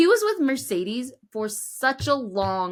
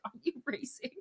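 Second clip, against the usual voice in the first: curt quiet retort; 4 semitones higher, 11 dB quieter, faster than usual. Retold or imitated. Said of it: "And it's like".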